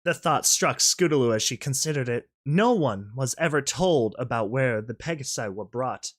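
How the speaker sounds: plain reading voice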